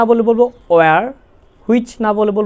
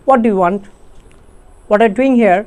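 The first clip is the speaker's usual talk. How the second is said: normal talking voice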